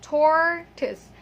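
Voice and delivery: tortoise slowly split as TOR, tuhs; syllable breakdown. Shown as a demonstration. Teacher breaking the word down for learners, syllable breakdown. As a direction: stretch TOR in tortoise, then a schwa ending. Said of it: "the last vowel sound there is a schwa sound".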